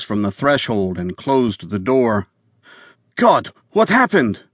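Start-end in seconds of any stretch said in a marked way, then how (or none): 3.2-4.4 high, shocked question; alarmed. Acted. Wife rushing to the bleeding man, frightened cry. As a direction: gasp it out in fright.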